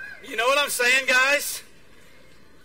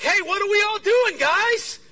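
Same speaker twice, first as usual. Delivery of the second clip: high, loud, eager call to the group; rally your friends, raring to go; retold burst of eagerness; excited; 11 semitones higher, 9 dB louder than usual. Newly baptized, eager to act.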